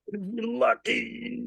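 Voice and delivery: high, slow, effortful squeeze of words; straining under weight. Acted. Lifter fighting a heavy bench press, straining under weight. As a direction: push it out as if lifting.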